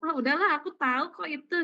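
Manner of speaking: a hint of a bored pupil brushing it off; dismissive child. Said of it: "anak ketika yang sudah dia mengerti".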